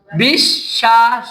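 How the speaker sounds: বিশ্বাস drawn out slowly, sound by sound; slow spelling demo. Shown as a demonstration. Teacher slowly sounds out বিশ্বাস for the pupils. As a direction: stretch বিশ্বাস out so each sound is heard. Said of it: "তালব্য শ কয়বার লিখবো".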